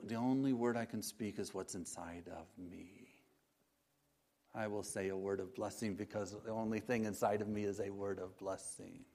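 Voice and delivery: soft, hushed reply that fades away; gentle Jesus. Acted. Jesus explains his blessing to the disciples, gentle and hushed.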